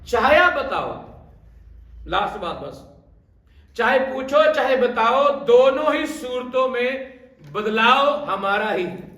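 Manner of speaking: high, loud, rousing sermon cry; fiery preaching. Acted. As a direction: whip the congregation up, shouting to the back.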